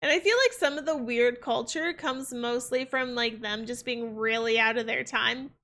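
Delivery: ordinary talk, nothing marked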